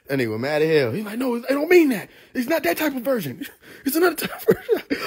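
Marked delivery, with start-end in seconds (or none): 1.2-5.0 high, pleading backpedal; lightly voiced flustered street preacher; mimicked preacher. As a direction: play the flustered preacher, pitched up.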